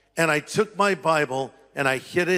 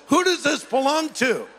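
mock mother; 7 semitones higher than usual. Mother quoted again.

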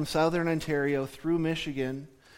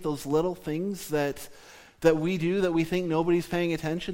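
normal talking voice

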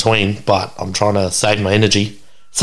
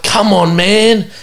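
pleading; 7 semitones higher than usual. Retold or imitated. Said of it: "I'm like".

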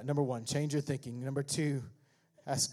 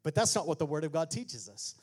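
ordinary talk

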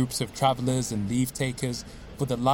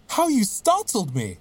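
startled; 7 semitones higher than usual. Acted. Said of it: "I cried".

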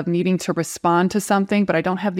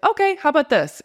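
much higher, helpful bouncy offer; eager chatbot; 8 semitones higher than usual. Retold or imitated. Chatbot offering the user a new draft, eager. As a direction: play the chatbot eager to please. ChatGPT voiced offering a revision.

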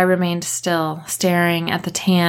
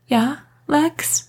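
higher tender answer; caring; 9 semitones higher than usual. A narrator's reading. Kat answering Lex gently, higher, caring. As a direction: sound like a caring friend. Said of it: "caring friend".

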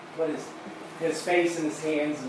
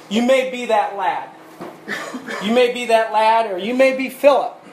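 louder, higher full-force sermon delivery; preaching; 5 semitones higher, 7 dB louder than usual. Real, not acted. Preacher pleading with the congregation, preaching at full force. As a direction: drive the message home to the congregation.

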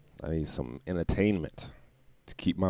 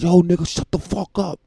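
higher, louder retold yell from across the street; imitated angry neighbor yell; 10 semitones higher, 7 dB louder than usual. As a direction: bark it like an annoyed neighbor. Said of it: "It was like".

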